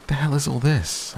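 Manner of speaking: sudden high, outraged disbelief; indignant, flustered outburst; spluttering. A narrator's reading. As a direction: sputter in shock at the room. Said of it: "I spluttered".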